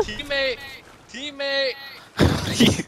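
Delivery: high loud call. Real, not acted. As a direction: call out to your teammate. Calling teammate in game.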